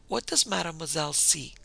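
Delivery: ordinary delivery, nothing marked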